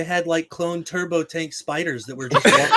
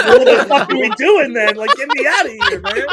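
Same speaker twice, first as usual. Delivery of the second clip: loud, high panicked yell, half laughing; hammed-up alarm, pitched high; mock panic; 8 semitones higher, 8 dB louder than usual. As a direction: yell it like you want out now. Acted panic on seeing snakes.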